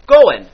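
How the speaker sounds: "going" said "goin'", punched loud; dropped g. Shown as a demonstration. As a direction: clip the final g off "going". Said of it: "I did drop that final J".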